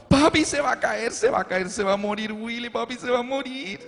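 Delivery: put-on a small girl in distress; frightened child. Daughter on father's shoulder fearing Willy dies, frightened cries.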